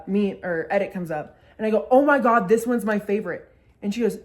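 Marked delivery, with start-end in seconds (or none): 1.9-3.5 loud, delighted exclamation; excited outburst. Retold or imitated. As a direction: burst out at a favorite video. Excited at favorite edit.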